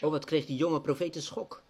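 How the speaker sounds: earnest prayer. Acted. Pastor praying before his small congregation, earnest and pleading. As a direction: pour out the heart in prayer.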